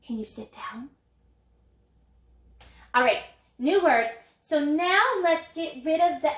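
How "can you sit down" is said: breathy whispered question after a gasp. Pretend. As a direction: whisper it like a secret. Teacher acts a sentence softly.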